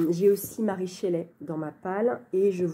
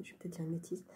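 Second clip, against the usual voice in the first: whispered aside; 16 dB quieter, faster than usual. Real, not acted. Unsure aside about Romain Gary.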